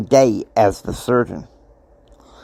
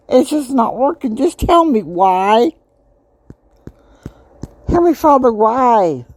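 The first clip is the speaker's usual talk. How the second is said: much higher, anguished pleading voice; distressed pleading; 12 semitones higher than usual. Retold or imitated. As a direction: cry out to heaven in despair. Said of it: "I was like".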